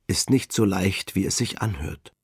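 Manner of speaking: usual speaking voice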